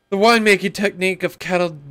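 fast, high, hammed-up English peddler; old British peddler voice. Ham up the old English peddler accent. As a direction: player reading item notes as British peddler, hammed up. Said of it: "this old English British peddler guy's voice".

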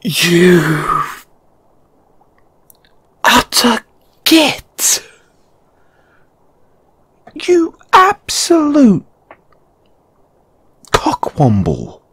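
high-pitched, drawn-out indignant outburst; exasperated insult. Acted. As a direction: stretch each insult out high, then drop on the last word. Playful outburst of insults at partner.

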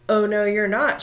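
loud, emphatic troll refusal; forceful storybook troll outburst; troll shout. A narrator's reading. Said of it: "shouted the troll".

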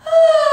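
long high open sigh. Shown as a demonstration. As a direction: let out a big sighing ah. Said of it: "And just sigh".

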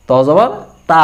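"তা" with a long open aa; wrong Bangla reading. Shown as a demonstration. Teacher showing what Bangla spelling gives, mispronounced. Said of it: "আকার দিয়ে উচ্চারণ করা আদৌ সম্ভব না".

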